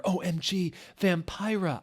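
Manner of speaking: high excited greeting call; yelled. A narrator's reading. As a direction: call out in delight at seeing him. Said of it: "I yielded".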